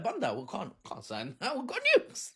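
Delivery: high, cheeky mock voice with a laugh; smug Israel. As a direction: play it as a gloating, laughing boast. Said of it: "they're like".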